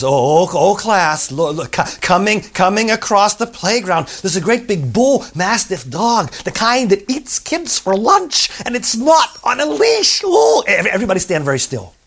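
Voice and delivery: alarmed teacher. Acted. Teacher spotting a big loose dog, rising panic. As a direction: warn the kids in growing panic.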